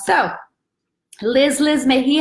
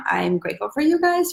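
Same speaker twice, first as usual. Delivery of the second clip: ordinary talk, nothing marked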